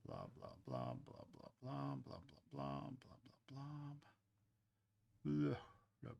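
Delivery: soft, rhythmic blah-blah sound effect; playful slathering noises. Pretend. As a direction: murmur blahs in time with the work. Noises while spreading slip.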